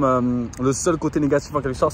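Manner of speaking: normal talking voice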